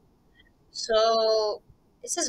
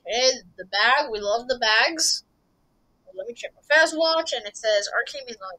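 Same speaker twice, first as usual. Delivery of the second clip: ordinary delivery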